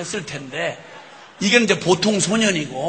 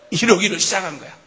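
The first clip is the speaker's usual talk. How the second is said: ordinary talk, nothing marked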